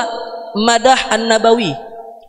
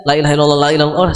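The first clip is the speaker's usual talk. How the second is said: rushed tahlil; 8 semitones lower, much faster than usual. Shown as a demonstration. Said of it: "Kalau kebut-kebutan nggak usah pakai nada".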